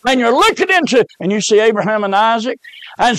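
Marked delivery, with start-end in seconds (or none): 0.1-1.0 preaching fervor. Acted. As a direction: press each word home.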